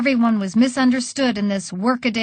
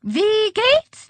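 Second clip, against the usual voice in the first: very high-pitched hallooing; calling out; 12 semitones higher, 3 dB louder than usual. A narrator's reading. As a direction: call a greeting across in a high voice. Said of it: "I took to calling".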